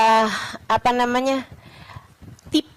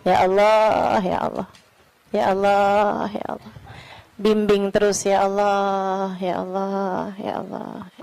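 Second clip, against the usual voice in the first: pleading prayer; 4 semitones lower than usual. Real, not acted. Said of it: "minta perlindungan sama Allah".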